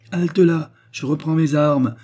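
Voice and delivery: voix forte et haute qui arrête l'autre; contre-attaque indignée. A narrator's reading. Mari accusateur qui contre-attaque. (contre-attaque indignée)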